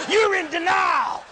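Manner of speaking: high, slow, loud chant of blame; hammed-up confrontation; mock group accusation. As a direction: pronounce it at him together, drawn out. Group confronting man in chair.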